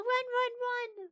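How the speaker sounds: high panicked run, run; frightened high-pitched warning; scared yelling. Pretend. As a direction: squeal it out in fright. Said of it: "because I'd be scared".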